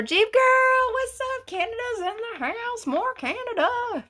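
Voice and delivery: very high, sing-song hello; excited greeting. Real, not acted. Host welcoming viewers from Canada, high sing-song greeting. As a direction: call out each new viewer happily.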